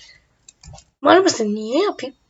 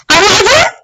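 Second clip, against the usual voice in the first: higher, louder "Anladın?" with a rising end; louder insistent repeat; 6 semitones higher, 5 dB louder than usual. Acted. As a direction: press "Anladın?" again, mock threatening.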